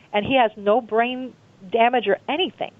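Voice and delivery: usual speaking voice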